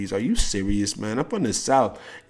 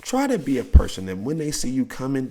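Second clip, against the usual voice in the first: ordinary delivery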